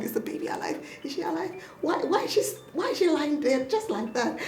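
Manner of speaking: high, hushed mock panic; freaking out. Doctor mother checking her sleeping newborn, retold panic. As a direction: fret in a high, softened voice. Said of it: "I was just freaking out".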